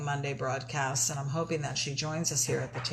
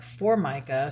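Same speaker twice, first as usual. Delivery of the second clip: normal talking voice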